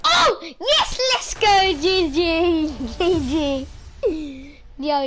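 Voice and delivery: excited cheering. Real, not acted. Wins round, cheering.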